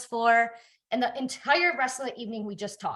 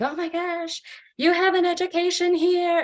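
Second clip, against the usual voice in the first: parents protesting; 9 semitones higher, 4 dB louder than usual. Retold or imitated. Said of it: "They're like".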